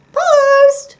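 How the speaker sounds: very high, loud squeaky call. A narrator's reading. Postman calls at Fox's door, squeaky. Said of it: "called a squeaky voice".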